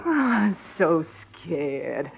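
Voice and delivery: sarcastic. Acted. Girlfriend mocking Jake's threat, sarcastic. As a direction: drip with sarcasm.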